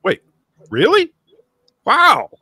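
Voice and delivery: retold amazed exclamation, pitched high; surprised disbelief. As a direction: shoot way up in pitch, stunned. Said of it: "I was like".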